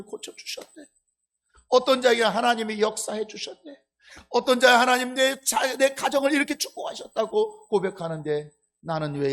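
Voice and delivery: 높고 크게 따지듯 늘어놓는 한탄; 남과 비교하며 억울해하는 교인 흉내; 억울한 하소연. Acted. 비교하며 신세 한탄하는 교인 연기. (속상한 듯 목소리 높여 하소연)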